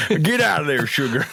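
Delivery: scolding the sugar in a hammed-up Southern accent. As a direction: shoo the sugar away in a put-on Southern accent. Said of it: "we always turn Southern".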